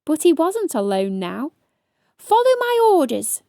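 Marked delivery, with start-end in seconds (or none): 2.2-3.4 higher, louder commanding shout; shouted order. A narrator's reading. Ladybird taking charge of hedgehog, loud command. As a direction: bark the order. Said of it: "shouted Stripey".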